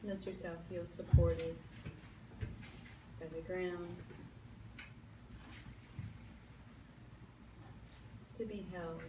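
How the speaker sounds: calm meditation voice with long pauses; guided meditation. Acted. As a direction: lead them gently into stillness.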